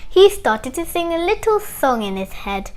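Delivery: ordinary delivery, nothing marked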